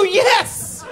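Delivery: high exasperated yell; exasperated yes. Retold or imitated. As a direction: shout the answer in disbelief. Exasperated at wife.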